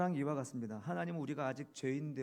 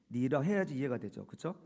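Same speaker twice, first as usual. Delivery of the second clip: ordinary talk, nothing marked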